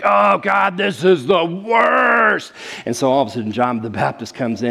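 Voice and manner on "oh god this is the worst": high, loud exasperated whine shouted slow; mock grumbling. Grumbling people in the wilderness complain to God, loud high mock wail. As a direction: ham up a fed-up complainer wailing to the sky. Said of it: "They're like".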